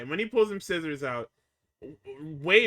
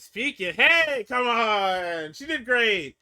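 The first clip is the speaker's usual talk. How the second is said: loud, high, pushy retold shout; mock insistent hollering; hyped-up yell; 6 semitones higher, 8 dB louder, much slower than usual. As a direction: hype up the room as Rox. Rox demands praise for Liv.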